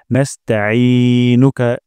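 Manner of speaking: নাস্তাঈনুকা with ঈ from mid-throat, no hamza; slow recitation. Shown as a demonstration. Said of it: "আইন উচ্চারণ করব গলার মাঝখান থেকে". (গলার মাঝখান থেকে আইন দিয়ে নাস্তাঈনুকা)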